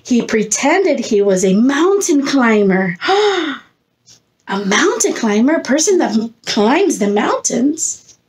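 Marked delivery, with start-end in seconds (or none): 3.0-3.6 surprised gasp. A narrator's reading. Reacting to mountain climber.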